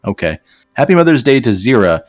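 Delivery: normal talking voice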